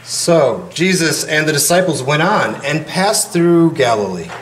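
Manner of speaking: steady formal gospel reading; scripture reading. Acted. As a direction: proclaim it to the congregation. Reads the gospel aloud.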